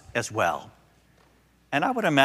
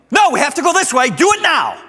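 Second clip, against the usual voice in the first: much higher and louder, a screaming demand; toddler tantrum; 14 semitones higher, 10 dB louder than usual. Retold or imitated. Granddaughter screaming at grandpa to go her way, high and loud. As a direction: shriek it like a furious three-year-old.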